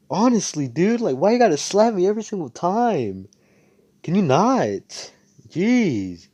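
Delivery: high, loud whining protest; mock-aggrieved outburst; acted exasperation. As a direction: complain loudly after being slapped. Slapped character complains.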